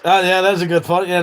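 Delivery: ordinary delivery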